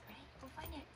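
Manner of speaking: whispered go find it; whispered cue. Real, not acted. Sending puppy to search.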